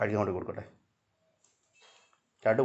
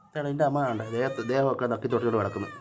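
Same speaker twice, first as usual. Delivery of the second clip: normal talking voice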